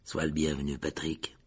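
low, soft, stiffly polite welcome; grudging formality. A narrator's reading. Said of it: "dit Nick d'un ton raide".

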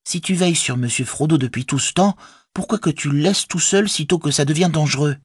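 put-on high-pitched, pointed young woman; chiding Rosie. A narrator's reading. Rosie questions Sam leaving Frodo alone, chiding.

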